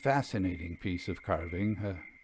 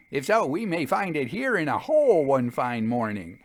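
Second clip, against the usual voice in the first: lightly voiced friend; 4 semitones higher, 4 dB louder than usual. A narrator's reading. Narrator voices Jasper.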